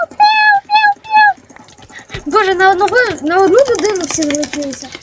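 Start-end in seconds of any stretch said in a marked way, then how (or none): 0.1-1.4 high mouth-made blaster sounds; pew pew gun noises. Pretend. Gamer mimicking his shots with high pew pew noises. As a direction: zap like a toy laser.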